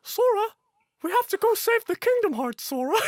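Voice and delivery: very high, loud squeak; hammed-up Disney sidekick; mock squeaky duck voice; cartoon sidekick voice. Kingdom Hearts voices bit.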